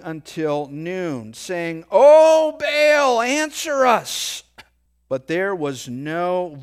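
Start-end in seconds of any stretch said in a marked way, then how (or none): 1.9-4.4 loud, high, desperate call; crying out to Baal. Acted. Prophets of Baal calling out to their god, loud desperate cry. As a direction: plead upward at the top of the voice.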